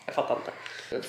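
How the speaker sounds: slow, unvoiced whisper; whispered trail-off. Vlogger ending her imagined rant in a whisper. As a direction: let it die away into a whisper.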